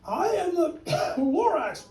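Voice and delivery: high, coughing little creature voice; the Lorax announcing himself through a cough. Acted. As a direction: splutter it out in a high voice. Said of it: "He coughed and he whiffed".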